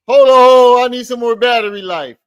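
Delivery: high, loud mock excuse; mocking imitation. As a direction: cry out the feeble excuse in mockery.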